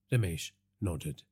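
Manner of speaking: usual speaking voice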